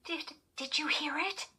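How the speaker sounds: high-pitched, stammering question; frightened stammer. A narrator's reading. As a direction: stumble anxiously over the first word. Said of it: "Chris stammered".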